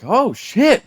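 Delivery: high-pitched surprised exclamation; retold astonishment. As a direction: act out a startled double take. Retold shock at seeing slimmed coworker.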